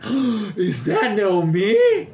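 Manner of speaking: gasp, then very slow, high, loud disbelief; delighted shock. Acted. Townspeople spot Naomi coming back poor, gleefully shocked. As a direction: stretch it out, relishing the shock. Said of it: "shocked but really enjoying being shocked".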